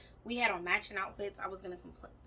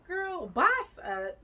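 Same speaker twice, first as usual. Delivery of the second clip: aunt's pep talk; 9 semitones higher, 7 dB louder, much slower than usual. Retold or imitated. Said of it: "She was like".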